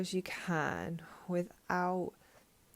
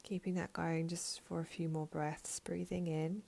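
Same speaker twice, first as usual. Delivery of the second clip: guided meditation; 3 dB quieter than usual. Real, not acted. Teacher guiding listeners to breathe in lightness and exhale heaviness, guided meditation voice. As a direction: lead the breath calmly, leaving space after each phrase.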